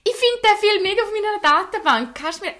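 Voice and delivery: nosy stranger voice. Retold or imitated. Imitating seatmate asking questions.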